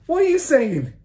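high, loud alarmed cry; husband's cry. A narrator's reading. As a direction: cry out in disbelief. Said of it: "the husband cried".

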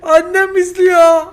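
high, slow, drawn-out shout; long, high-pitched cry of protest; loud outcry. Real, not acted. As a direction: yell it out high and stretched. Sudden outcry that mother is watching.

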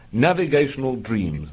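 usual speaking voice

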